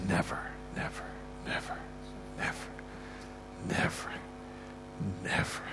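hushed, breathless repeated never; whispered never. Retold or imitated. Quoting the man's whispered never.

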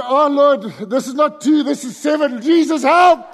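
high, loud mock plea to God; comic alarmed protest; panicked prayer. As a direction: plead upward, building to a cry for help.

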